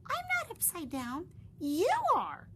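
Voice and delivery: insist, pushing back; high, last words punched; young bat insisting. Acted. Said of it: "Stellaluna said".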